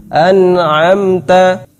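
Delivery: An'amta slow, nun clear; Quran recitation. Shown as a demonstration. Teacher giving Al-Fatihah example. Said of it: "dalam surah Al-Fatihah".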